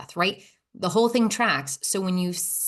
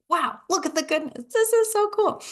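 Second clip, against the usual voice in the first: so excited; 11 semitones higher than usual. Retold or imitated. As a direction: squeal with delight at the view. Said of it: "I was so excited".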